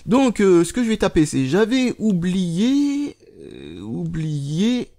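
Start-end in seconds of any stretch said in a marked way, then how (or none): 1.5-4.9 very slow, stretched-out words; typing aloud. Real, not acted. Vlogger dictating a tweet to himself while typing, drawn-out. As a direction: draw out each word as you type.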